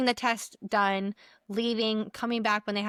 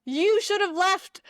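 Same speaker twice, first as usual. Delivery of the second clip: much higher, louder, exasperated scolding; incredulous scolding; 8 semitones higher, 4 dB louder than usual. Retold or imitated. Coworker scolding the sick friend who stayed, incredulous high loud voice. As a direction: demand why she is still here. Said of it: "And I was like".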